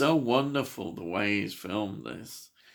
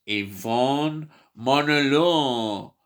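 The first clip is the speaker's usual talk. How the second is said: put-on slow, drawn-out French accent, louder and higher; 4 semitones higher, 5 dB louder, much slower than usual. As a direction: savour the name in a hammed-up French accent. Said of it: "My French accent".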